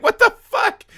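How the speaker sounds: high laughing what-the; startled. Retold or imitated. Retelling his shock at a midnight siren, high laughing.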